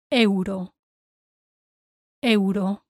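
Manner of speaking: Euro as EH-oo-ro; Spanish vowels in Euro, tapped r; E and U sounded. Shown as a demonstration. Teacher models for learners, Euro as EH-oo-ro. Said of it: "Remember to pronounce the E".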